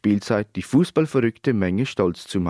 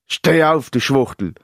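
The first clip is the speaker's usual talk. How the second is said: loud opening, angry jeer; heated shout, easing at the end; enraged yell; 3 semitones higher, 5 dB louder than usual. A narrator's reading. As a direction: yell it in anger at a fallen opponent. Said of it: "schrie er wutentbrannt".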